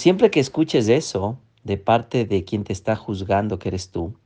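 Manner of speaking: usual speaking voice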